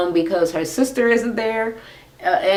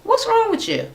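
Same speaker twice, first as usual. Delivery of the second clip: surprised question. Retold or imitated. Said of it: "looked at her sister".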